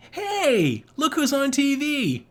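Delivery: high, drawn-out mock-cheery call; Angela. Angela teasing Molly while pretending to comfort her, high sing-out. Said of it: "And then she goes".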